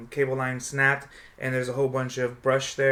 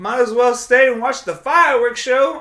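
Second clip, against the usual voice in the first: imitating her dad; 9 semitones higher, 7 dB louder than usual. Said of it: "And he says".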